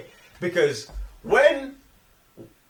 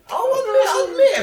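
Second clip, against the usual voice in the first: high, laughing squeal of an admission; laughing, higher, owning up; 4 dB louder than usual. Real, not acted. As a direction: crack up as you confess. Laughing while admitting a bias.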